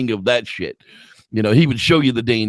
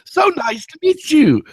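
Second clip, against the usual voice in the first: chirpy polite couple. Retold or imitated. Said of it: "They were just".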